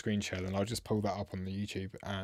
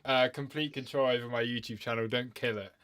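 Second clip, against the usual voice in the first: needing the toilet; 5 semitones higher, 7 dB louder than usual. Real, not acted. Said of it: "otherwise I'm gonna wet myself".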